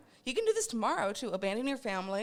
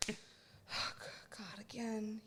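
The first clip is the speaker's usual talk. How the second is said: weary sigh; 8 dB quieter, much slower than usual. Pretend. Imagined weary impersonator sighs.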